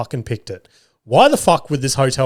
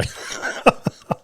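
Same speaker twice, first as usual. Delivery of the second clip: short laugh at own blunder; 6 dB quieter than usual. Real, not acted. Podcaster hears their own mangled French, laughing. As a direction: crack up briefly at your own botched attempt.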